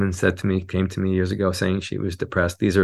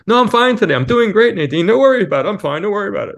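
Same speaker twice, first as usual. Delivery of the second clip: much higher, louder, brightly insisting she's fine; chirpy fake cheer, waving off concern; forced cheerful brush-off; 12 semitones higher, 6 dB louder, faster than usual. Client insisting to Nadine she's fine, forced high cheerful brush-off. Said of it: "I'm trying to be up".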